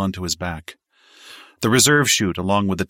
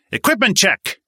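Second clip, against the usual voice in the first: barked order, much higher and louder; shouted command; 7 dB louder than usual. A narrator's reading. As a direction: bellow it like a jump master. Jump master barks orders.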